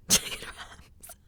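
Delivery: slow, hushed "Chicken wraps"; drawn-out breath-only whisper. Acted. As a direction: whisper it in mock disbelief. Repeats the absurd cause in whisper.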